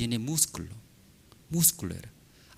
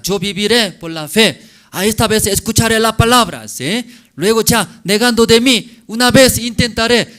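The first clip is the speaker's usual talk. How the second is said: higher, louder, quick determined vow; eager resolve; 8 semitones higher, 6 dB louder than usual. Acted. Preacher voicing a believer who decides to try, eager and loud. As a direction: vow it like someone who's finally going to try.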